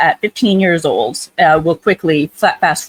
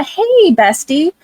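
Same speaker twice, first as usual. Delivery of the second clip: higher, sing-song mock teen friend; bubbly bestie greeting; 10 semitones higher, slower than usual. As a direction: chirp it like a gushing teenager.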